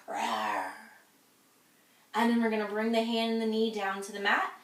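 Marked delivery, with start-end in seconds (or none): 0.1-0.7 much lower growling rawr; playful tiger growl. Shown as a demonstration. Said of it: "can you growl like a tiger".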